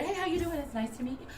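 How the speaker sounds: customer service voice. Shown as a demonstration. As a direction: switch on the sweet voice for a client. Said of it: "Voice still on".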